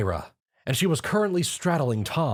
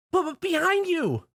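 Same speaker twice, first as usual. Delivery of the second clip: very high stammering scream of warning; terrified shriek. A narrator's reading. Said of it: "she shrieked".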